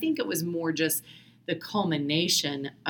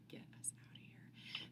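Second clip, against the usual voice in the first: breathless hushed whisper; 26 dB quieter than usual. Retold or imitated. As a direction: whisper it urgently. Desperate plea to leave, retold.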